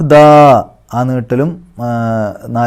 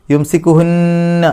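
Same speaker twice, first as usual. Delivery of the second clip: slow, higher chant; Quran recitation; 5 semitones higher, much slower than usual. Shown as a demonstration. Teacher chants the word with ghunna. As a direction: hum the nun as you chant. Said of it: "നൂനിൻ്റെ ശബ്ദം മണിക്കുക".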